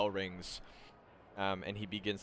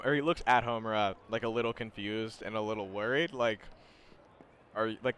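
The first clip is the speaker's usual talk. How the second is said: normal talking voice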